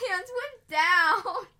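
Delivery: laughing through the words. Real, not acted. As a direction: giggle while telling it. Laughing at sad memory.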